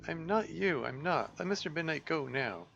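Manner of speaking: put-on frightened girl. Girl defends her cat.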